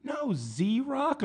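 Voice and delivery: very high, eager mock voice; imitated lady fan. Older lady naming his show, in a high imitated voice. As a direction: correct him in a high, excited squeak. Lady corrects him, acted.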